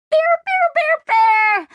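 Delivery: very high, loud repeated squeals; overjoyed high-pitched shrieking; excited squealing. A narrator's reading. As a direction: squeal it over and over, stretching the last. Excited Kizzy runs to Bear.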